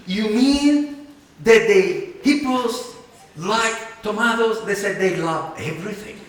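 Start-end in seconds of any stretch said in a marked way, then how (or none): 0.1-4.6 incredulous. Retold or imitated. Retold disbelief about hippos.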